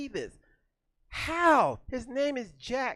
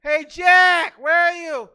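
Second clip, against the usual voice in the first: loud, high calling for Jack; landlord calling out; 8 semitones higher, 14 dB louder, much slower than usual. Retold or imitated. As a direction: holler for the tenant across the house. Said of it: "a landlord coming around going".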